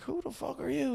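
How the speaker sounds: high, hushed mock demand; puzzled bar owner. Bar owner to man who claims to know him, mock puzzled. Terry's puzzled reply acted.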